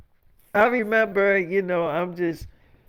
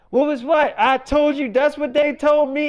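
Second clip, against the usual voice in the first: higher, protesting self-defence; retold exasperated defence; 7 semitones higher, 4 dB louder than usual. Husband defending what he was told, exasperated. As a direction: protest as the wrongly blamed husband, pitched up.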